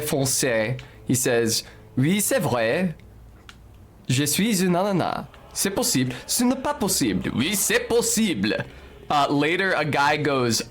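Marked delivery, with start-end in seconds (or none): 2.0-8.6 imitated TV French exchange, pitch climbing; lightly voiced French TV characters; French show dialogue. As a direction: act out a French show's back-and-forth. Acting out French children's show dialogue.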